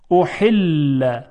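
احل slowly, with a squeezed ح; constricted Arabic ح. Shown as a demonstration. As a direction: push air through a tight throat on the ح of احل. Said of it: "حالا با هی جیمی". (تلفظ کشیدهٔ احل با حای پرفشار)

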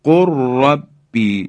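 قل ربی as qurrabbi, trilled r; lam merged into ra. Shown as a demonstration. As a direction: slowly join قل ربی with a long r. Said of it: "دیگه لام رو ما تلفظ نمی کنیم".

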